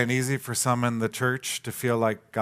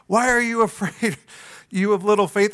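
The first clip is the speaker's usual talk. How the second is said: higher, louder, half-teasing Jesus; amused Jesus; 7 semitones higher, 4 dB louder than usual. Retold or imitated. Jesus teasing the terrified disciples, amused. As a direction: play Jesus lightly mocking his soaked disciples. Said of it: "I just imagine the comedy of this scene".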